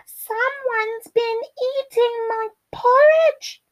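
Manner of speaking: tiny, very high squeaky cub wail; crying Baby Bear. A narrator's reading. Baby Bear finds his porridge eaten, high-pitched cry. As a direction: squeal it like an upset little bear. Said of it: "cried Baby Bear".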